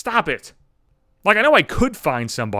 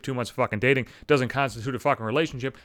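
ordinary talk, nothing marked

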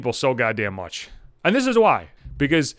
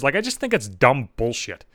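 normal talking voice

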